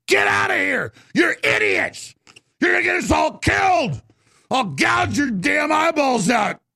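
high-pitched enraged threat, words stretched; furious outburst. Acted. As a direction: yell them out of the shop. Acted outburst at clueless amateurs.

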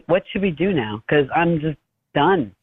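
ordinary talk, nothing marked

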